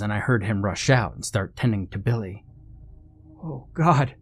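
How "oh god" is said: high, anxious gasp of dismay; alarmed dismay. Acted. Friend finds the fallen one hurt, alarmed. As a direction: react in rising alarm, pitched up.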